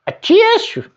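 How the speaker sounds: high, sneezy a tissue; mock sneeze. A narrator's reading. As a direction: sneeze the word out. Pig tries the sneeze.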